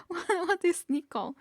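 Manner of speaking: high, drawn-out mock bewilderment; amused retold bafflement. Player mocking own confusion over an unknown word, high and amused. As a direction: exclaim it slowly and high through amusement. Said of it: "It's just like".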